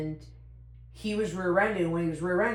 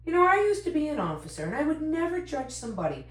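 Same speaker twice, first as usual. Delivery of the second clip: lecturing cop; 11 semitones higher, 3 dB louder than usual. Retold or imitated. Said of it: "he went into".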